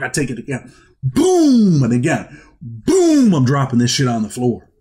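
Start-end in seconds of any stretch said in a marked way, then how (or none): 1.0-1.8 a sudden high, loud boom sound; imitated boom. To the noisy cellmates below, a high loud boom. Throwing legal work on floor. 2.6-3.3 smack out the noise of the bag hitting the floor; imitated boom. Throwing legal work on floor.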